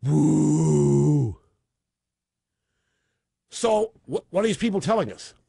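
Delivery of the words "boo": low, loud imitated boo; imitating the booing audience; mock crowd boo. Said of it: "fifteen seconds of booze".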